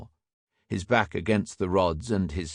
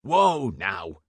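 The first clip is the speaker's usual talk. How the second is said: protesting shout, only a little raised. A narrator's reading. Inspector pinned to the railings, shouting in protest. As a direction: call out to halt them. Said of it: "shouted Field".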